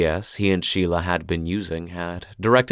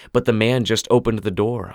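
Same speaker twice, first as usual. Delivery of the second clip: ordinary delivery, nothing marked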